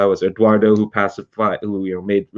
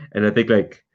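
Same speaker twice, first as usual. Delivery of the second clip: normal talking voice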